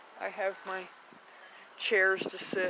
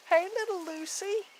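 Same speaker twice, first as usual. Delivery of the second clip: higher, slow coo to the pet; slow pet-talk coo; 11 semitones higher than usual. Real, not acted. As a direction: croon it to the little one. Greeting pet.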